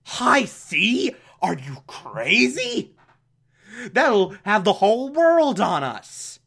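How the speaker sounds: mock alarm with swooping pitch; incredulous henchmen. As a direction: question it in exaggerated disbelief. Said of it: "everyone around him is like".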